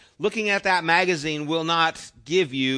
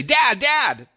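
put-on high, loud child's call; child yelling for dad; 8 semitones higher, 7 dB louder than usual. Kid falling calls to his father, high loud yell. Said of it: "Like when your kids are falling".

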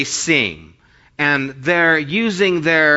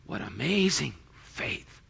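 hushed, soft wonder; moved admiration; 3 semitones lower, 12 dB quieter than usual. Retold or imitated. Jesus marveling at the mother's faith, moved admiration. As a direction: say it softly, deeply moved. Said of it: "Jesus can't contain himself".

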